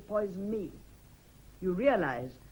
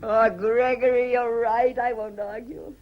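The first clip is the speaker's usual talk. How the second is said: gushing surrender; 2 semitones higher, 10 dB louder, slower than usual. Acted. Wife gives in, swept up.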